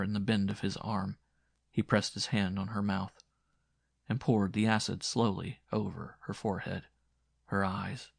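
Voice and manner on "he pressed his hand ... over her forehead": usual speaking voice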